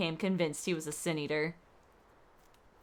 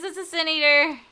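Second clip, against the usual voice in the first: "It's a Sin Eater" yelled loud and high in mock alarm; mock alarmed shout; 7 semitones higher, 7 dB louder than usual. Streamer jokingly warning the party, "It's a Sin Eater" shouted. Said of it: "Run for your lives".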